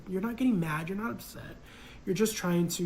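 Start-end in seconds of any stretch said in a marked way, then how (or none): none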